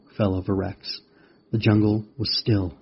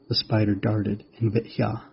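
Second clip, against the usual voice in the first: usual speaking voice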